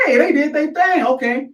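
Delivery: high-pitched hyped approval; impressed reaction. Retold or imitated. Continuing impressed reaction to series.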